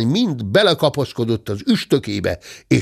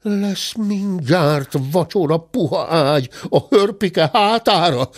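slow, higher-pitched character grumble; grumbling old bear; 7 semitones higher than usual. A narrator's reading. Bear slipping away along the corridor, grumbling. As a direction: grumble it out slowly as a storybook bear. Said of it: "dörmögte a bátya".

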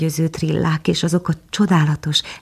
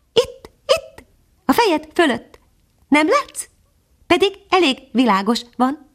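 much higher, small bird voice; a little hidden bird answering from the branches; tiny nightingale; 12 semitones higher than usual. Acted. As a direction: speak as a tiny, gentle songbird.

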